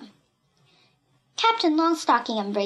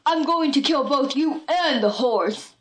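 villain's yell, held back in volume; threatening yell; 2 semitones higher than usual. A narrator's reading. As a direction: threaten in a put-on bully voice. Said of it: "he yelled".

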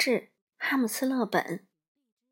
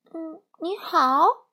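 unsure guess; 6 semitones higher, 4 dB louder, much slower than usual. A narrator's reading. Peipei guesses again.

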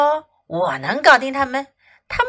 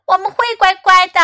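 put-on high, quick children's voice; kids promising; 6 semitones higher, 5 dB louder, faster than usual. A narrator's reading. Scolded Ling kids answer Fox, childlike promise. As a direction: pipe up like obedient children.

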